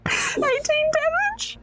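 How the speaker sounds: high, slow, laughing question; laughing disbelief. Real, not acted. Player laughing at killing Joffrey, high and drawn out.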